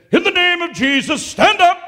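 high, loud command; commanding shout. Retold or imitated. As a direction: order the dead woman to rise. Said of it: "and he said".

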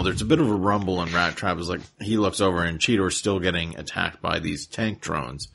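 usual speaking voice

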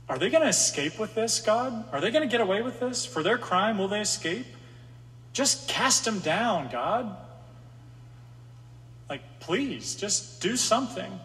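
high, pleading panic, voice rising to God; panicked prayer. Retold or imitated. David crying out to God in panic. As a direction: beg God to act, frantic and desperate. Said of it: "feel the panic in his voice".

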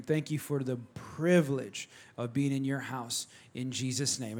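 soft, low prayer voice. Acted. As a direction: pray it quietly and humbly. Leads a prayer.

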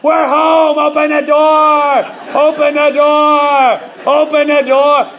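put-on high, loud, slow kids' yell; kids yelling. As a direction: bang on the door and holler like impatient kids. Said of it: "you hear them yelling through the mail slot".

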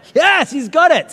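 high, loud, stretched-out cheer; real burst of triumph; delighted exclamation. Real, not acted. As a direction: celebrate someone finally getting the joke. Cheers listener getting the joke.

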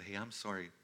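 calm him down gently, almost hushed; soft reassurance. Retold or imitated. Father comforting his scared son after the game, soft and tender. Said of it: "But I said".

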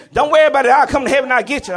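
high, loud fatherly yell from the front seat; angry dad yelling. Retold or imitated. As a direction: bark at the kids to stop. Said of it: "then how you gonna kill".

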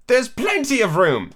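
indignantly. A narrator's reading. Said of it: "Alice said indignantly".

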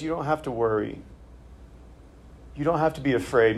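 soft, gentle reassurance. Retold or imitated. Jesus comforting anxious disciples, soft and gentle. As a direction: quietly comfort the frightened. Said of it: "No, he just says".

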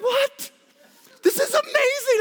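can't believe she isn't thrilled; squeaky high laughing outrage; incredulous squeal. Retold or imitated. Retelling his outrage at wife's indifference.

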